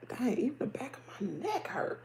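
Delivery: whispered aside about a sore neck; whispered complaint. Real, not acted. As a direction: breathe it to yourself, unvoiced.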